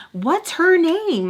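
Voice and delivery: high, loud, slow demand; insistent, stretched-out mock demand. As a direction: drag out the demand for her name. Demanding the woman's name.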